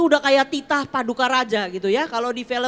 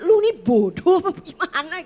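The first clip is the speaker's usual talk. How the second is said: imagined boss; 6 semitones higher, 6 dB louder than usual. Retold or imitated. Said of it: "Ntar dipikir".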